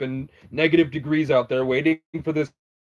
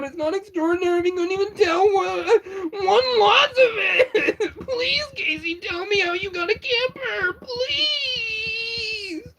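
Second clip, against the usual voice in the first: much higher, slow wailing fake sob; mock crying plea; slower than usual. Begging Casey in a hammed-up crying voice. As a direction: wail it like a hammed-up crybaby.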